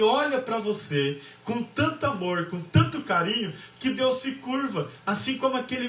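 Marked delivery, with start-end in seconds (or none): none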